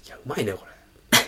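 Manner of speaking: hushed voice. Retold or imitated. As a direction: keep it down so the upstairs neighbour won't hear. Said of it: "この声量で喋んなきゃいけなかったから".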